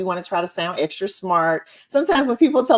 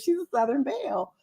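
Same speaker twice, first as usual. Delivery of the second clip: ordinary delivery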